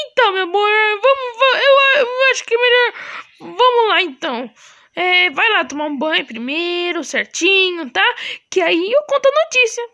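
high, sweet wife voice; lightly sung-out high wife voice. Acted. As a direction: greet him as the doting wife. Plays wife teasing about news.

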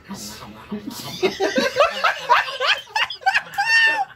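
high, shouted laughing fit; shrieking laugh. Real, not acted. Reader losing it mid-sentence, shrieking laugh.

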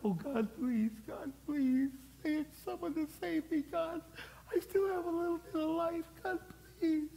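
very high, thin, faint begging; dying plea. Acted. Dying man begging God to save him, feeble pleading. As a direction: act out a weak falsetto prayer for rescue. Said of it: "my probably last words are".

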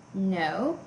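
usual speaking voice

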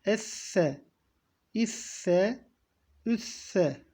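Esse with a lisped th-like s, not normal S; tongue between teeth in Esse; lisped s, th sound. Shown as a demonstration. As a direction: Esse as esthe, peltek S. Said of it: "Dikkat edin peltek S bu".

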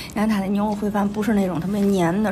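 ordinary talk

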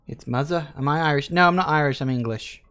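usual speaking voice

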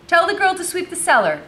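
high, loud called-out order; imperious mistress. A narrator's reading. Mistress ordering the cellar swept, imperious. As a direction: call it out high like a demanding mistress. Madam's order voiced in story.

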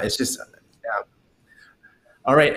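ordinary delivery

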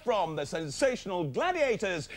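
slow, drawn-out grand introduction; show announcer. Acted. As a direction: build to a high, stretched-out star name.

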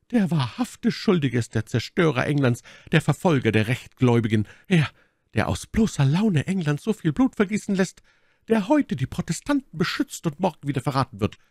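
hohe, leidenschaftliche Anklägerin; Milady, anklagend. Acted. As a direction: den Schuldigen mit hoher Stimme anprangern. (leidenschaftlich anklagende Frauenstimme)

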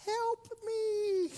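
high squeaky little voice of the drowning pills; cartoonish high cry for help; tiny pills crying help. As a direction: pipe up like a tiny pill begging for rescue. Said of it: "my pills were at the top of it going".

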